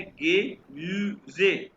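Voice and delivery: गे म्यूजे split into slow syllables; syllable-by-syllable pronunciation. Shown as a demonstration. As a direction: draw out each part of गे म्यूजे. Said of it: "कैसे pronounce करेंगे". (गे म्यूजे धीरे-धीरे अलग हिस्सों में)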